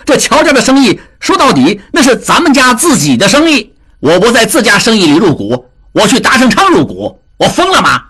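音调高、语速快的急眼争辩; 急得脸红脖子粗地辩白; 急赤白脸. A narrator's reading. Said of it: "达庆急赤白脸地说". (达庆被人揭短，急赤白脸地高声辩解)

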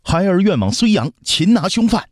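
put-on very high, eager boy prince; volunteer boldly in a child's high voice; childish young voice. Young prince volunteers from outside the hall, childlike and bold. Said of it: "传来稚嫩的声音".

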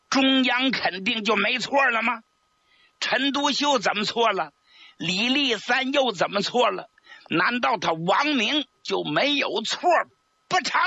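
high, scornful challenge; scornful retort. A narrator's reading. He Long scornfully retorts to Xia Xi, pitched high. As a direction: hurl each question back at him. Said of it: "贺龙就哼了一声".

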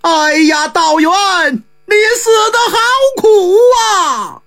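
high, wailing lament for the dead; wailing cry. Acted. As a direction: cry out his grief, voice breaking. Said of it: "有人大叫一声".

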